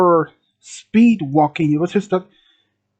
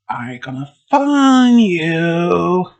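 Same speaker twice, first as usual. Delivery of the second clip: slow, drawn-out creepy threat; singsong menace, long-held vowels; taunting clown; 3 semitones lower, much slower than usual. Acted. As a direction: stretch each word like a hunting maniac. Clown taunts hiding children.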